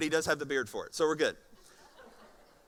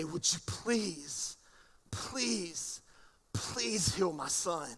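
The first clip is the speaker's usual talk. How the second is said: pleading; 4 dB quieter, much slower than usual. Acted. Father begging Jesus on his knees.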